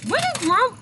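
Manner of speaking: a high, worried imitation of the friend; high mock worry. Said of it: "she was like".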